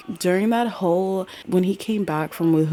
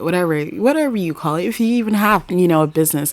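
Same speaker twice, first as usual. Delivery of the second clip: louder, quicker, fed up; louder exasperated repeat of whatever; exasperated louder rant; 2 semitones higher, 8 dB louder, faster than usual. Real, not acted. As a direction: push it out, fed up with him. Exasperated rant about dad.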